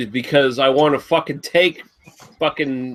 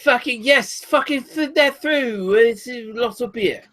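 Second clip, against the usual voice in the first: mock Irish accent; 9 semitones higher than usual. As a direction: ham up a high-pitched Irish voice. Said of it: "That's close to Irish".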